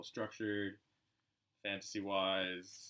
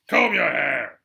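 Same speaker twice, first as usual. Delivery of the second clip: loud gruff bark; 4 semitones higher, 17 dB louder, much slower than usual. Acted. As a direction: bark it as a greeting. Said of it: "he barked".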